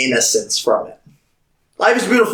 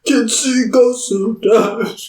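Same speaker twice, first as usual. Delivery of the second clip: ghost voice; 9 semitones higher, slower than usual. Pretend. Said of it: "Sound like a ghost".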